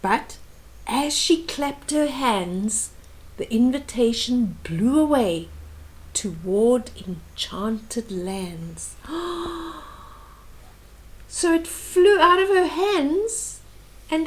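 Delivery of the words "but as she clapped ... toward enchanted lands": rhythmic read-aloud of a rhyming book; storybook reading voice; story narration. A narrator's reading. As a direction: read the verse gently to a small child. Reads picture book aloud.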